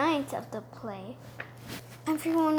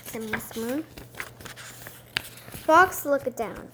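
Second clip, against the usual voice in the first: ordinary delivery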